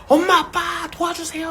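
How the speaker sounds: imitated child's shout. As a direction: cry out like a child summoning parents. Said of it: "하고 소리 지르는".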